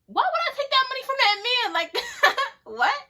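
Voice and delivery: high, loud, laughing through the words; incredulous laugh rushing the question; real laughing disbelief. Real, not acted. Laughing at the absurd offer.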